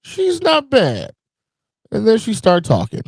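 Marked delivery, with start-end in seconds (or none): 0.0-1.1 high, slow mock gasp of approval; lightly mocking high voice; mock surprised. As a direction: go way up and drawl it out, impressed. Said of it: "I was like".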